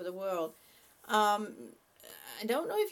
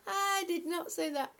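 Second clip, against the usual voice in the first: higher-pitched, through laughter; laughing denial; 6 semitones higher than usual. Real, not acted. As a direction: protest while giggling. Laughing denial of a teasing joke.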